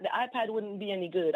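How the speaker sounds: usual speaking voice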